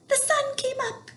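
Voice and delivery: very high chirpy squirrel; high squeaky squirrel chirp. A narrator's reading. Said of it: "he chirped".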